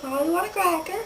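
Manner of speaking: lightly squawked pet-parrot phrase. Pretend. Playing animal noises as a parrot, talking high. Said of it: "I am a parrot".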